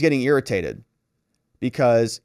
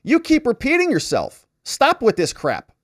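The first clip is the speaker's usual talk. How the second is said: higher, exasperated outburst; fed up, telling him to stop; upset; 11 semitones higher, 3 dB louder than usual. Retold or imitated. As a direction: witness losing patience with the prosecutor. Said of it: "Robbins is upset".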